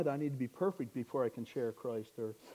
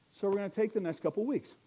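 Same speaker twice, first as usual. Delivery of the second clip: ordinary talk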